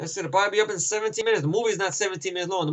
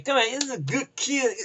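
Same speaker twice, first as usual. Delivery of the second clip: lightly higher joking voice; imitating Renner; 6 semitones higher than usual. Renner reassuring the scared kid, light higher mock voice. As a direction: toss it off as a pitched-up joke. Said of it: "He goes".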